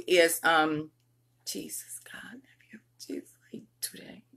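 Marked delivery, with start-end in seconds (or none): 1.4-4.2 whispered aside to herself. Real, not acted. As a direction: whisper it under your breath. Aside after a slip.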